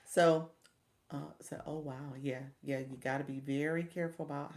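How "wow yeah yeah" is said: normal talking voice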